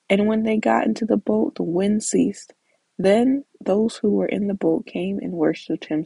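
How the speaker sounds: usual speaking voice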